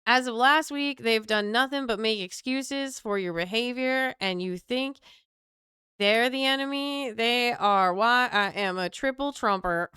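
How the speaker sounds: put-on Southern accent of an indignant supporter. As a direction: protest in a hammed-up Southern accent. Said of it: "give them a Southern accent".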